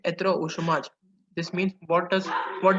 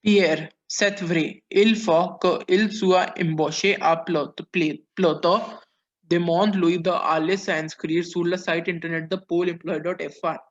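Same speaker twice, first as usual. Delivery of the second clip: reading Pierre's part aloud; 5 dB louder than usual. Acted. Reads dialogue roles aloud.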